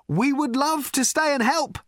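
high-pitched calling out; shouted. A narrator's reading. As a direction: shout it across the wreckage. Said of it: "shouted Ford".

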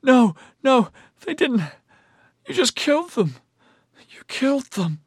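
high, tearful, shocked protest; whimpering. A narrator's reading. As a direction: plead through horror. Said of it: "she whimpered".

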